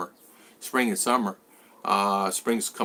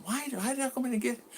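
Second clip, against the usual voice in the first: high, fast, exasperated self-quote; fired-up self-quote; 12 semitones higher, 9 dB quieter, faster than usual. Retold or imitated. Retelling his worry over the late check, fast exasperated self-quote. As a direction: replay your own frustration in a rush. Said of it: "I'm getting all fired up".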